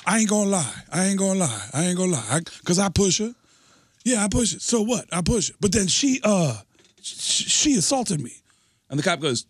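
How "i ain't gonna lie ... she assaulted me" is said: higher, pleading excuse; hammed-up guilty man talking fast; mock defensive guy. Accused man explains himself to the cop, hammed-up defensive. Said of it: "the guy goes".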